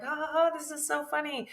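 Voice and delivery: high, mocking ha ha ha; mock laugh. Mother mocking her own careless laugh, high. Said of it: "I was like".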